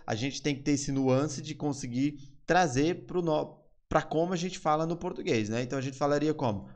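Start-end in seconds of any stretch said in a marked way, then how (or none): none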